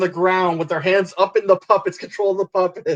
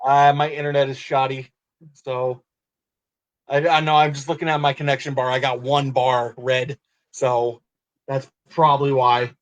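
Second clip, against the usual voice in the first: robotic distorted connection audio; glitchy robot-like bad internet call; 4 semitones lower than usual. Real, not acted. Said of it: "You still sound like a robot".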